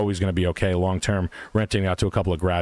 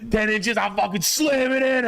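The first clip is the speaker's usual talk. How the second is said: crude tough guy; 12 semitones higher, slower than usual. Pretend. Joking crude macho bravado.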